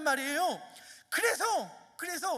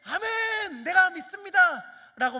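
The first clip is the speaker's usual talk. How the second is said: much higher, louder joyful confession; eager amen; 7 semitones higher, 9 dB louder, slower than usual. Acted. The hoped-for father's reply, a much higher, louder amen. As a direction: burst out with faith. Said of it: "말할 때".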